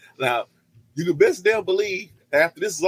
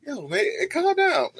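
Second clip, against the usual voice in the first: mock high pleading; 11 semitones higher, slower than usual. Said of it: "It's like".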